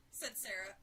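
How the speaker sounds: ordinary delivery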